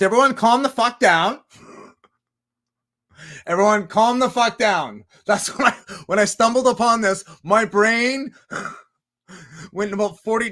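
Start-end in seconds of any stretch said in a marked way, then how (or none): 0.0-5.0 louder and higher, calling a rowdy room to order; mock calming shout. To his own thoughts, a loud call for calm. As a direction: shout them down to settle them.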